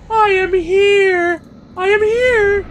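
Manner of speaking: high, loud exasperated cry; frustrated shriek. Real, not acted. Gamer yelling at confusing instructions. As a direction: yell in disbelief.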